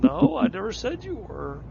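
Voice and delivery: squeaky retold denial; mock protest. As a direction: imitate yourself protesting in a high voice. Retold quarrel with wife.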